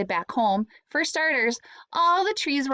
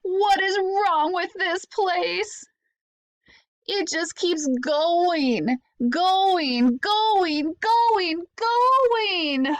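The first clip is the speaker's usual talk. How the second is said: frustrated shout; 8 semitones higher, much slower than usual. Acted. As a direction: yell it out, building on every going. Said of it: "threw his arms up into the air and shouted".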